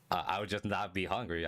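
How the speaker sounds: ordinary delivery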